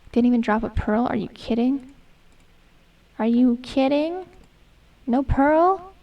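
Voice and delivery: normal talking voice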